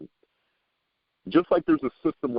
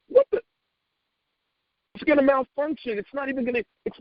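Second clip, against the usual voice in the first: mock confused driver; 11 semitones higher than usual. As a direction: squawk it like the sat-nav baffled you.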